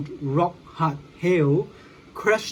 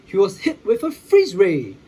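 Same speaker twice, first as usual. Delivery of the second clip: ordinary delivery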